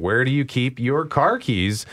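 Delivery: ordinary delivery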